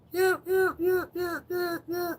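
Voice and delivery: high far-off yapping yeahs; imitating barking. Mimicking his Chihuahua barking far away, high yapping. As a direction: yap like a tiny dog in the distance. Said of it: "I can hear her barking".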